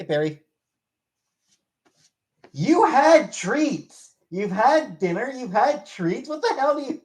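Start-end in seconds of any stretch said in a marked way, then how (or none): 2.5-7.0 high, slow sing-song scolding of a cat; pet scolding voice. Real, not acted. Owner scolding a begging cat, high sing-song scold.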